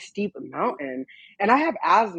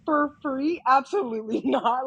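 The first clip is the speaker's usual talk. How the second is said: high, laughing disbelief; incredulous refusal; 6 semitones higher than usual. Retold or imitated. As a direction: scoff at the idea. Refusing to hike back unpaid.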